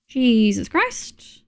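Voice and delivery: ordinary talk, nothing marked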